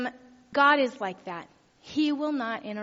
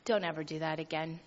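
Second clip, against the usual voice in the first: polite rebuke; 7 semitones lower, 7 dB quieter than usual. Retold or imitated. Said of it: "very politely said".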